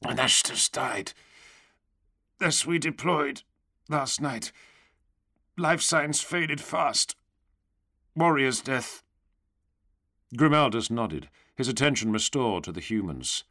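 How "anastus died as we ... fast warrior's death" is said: thick, halting voice, pitched up; mouthful of gruel. A narrator's reading. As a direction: speak through a mouthful of gruel, in short broken phrases. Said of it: "like a man speaking through a mouthful of gruel".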